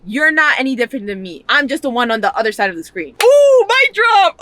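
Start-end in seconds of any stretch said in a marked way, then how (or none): 3.2-4.4 high, loud, slow mic-drop shout; excited shout. Real, not acted. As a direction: crow it out like a triumph. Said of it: "I just got a little too excited".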